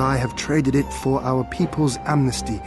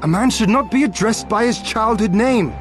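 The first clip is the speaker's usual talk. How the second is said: raised pitch, mildly affronted; indignant protest; 8 semitones higher than usual. Acted. Young man to his teasing elder, indignant. Bristles at childhood nickname.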